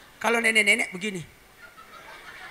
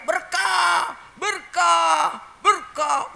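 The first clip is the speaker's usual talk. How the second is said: high joyful shouts; shouting; 10 semitones higher, 4 dB louder, much slower than usual. Retold or imitated. Villagers shouting as blessed rain falls, joyful high shout. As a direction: cry out in delight at the rain. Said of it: "Orang berteriak".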